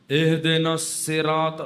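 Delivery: slow اہدنا الصراط with a plain s, no heavy ص; imitating mispronunciation. Preacher imitating the imam's wrong ص in اہدنا الصراط. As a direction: mimic the imam missing the ص sound. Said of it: "سواد نہیں نکل رہے".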